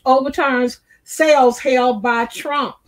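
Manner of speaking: normal talking voice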